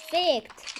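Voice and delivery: normal talking voice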